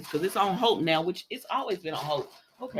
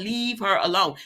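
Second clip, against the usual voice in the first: usual speaking voice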